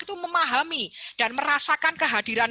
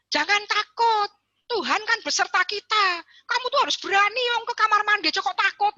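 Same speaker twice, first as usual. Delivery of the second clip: mock dogmatic parent, pitched up; stern preachy parent; 8 semitones higher than usual. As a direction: scold the child into being brave. Parent lecturing a scared child.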